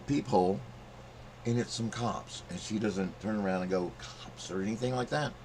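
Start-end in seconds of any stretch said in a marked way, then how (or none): 4.0-4.5 breathless whisper of a warning; mock whisper tipping off a friend. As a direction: hiss the word under your breath. Imagined warning she never gave.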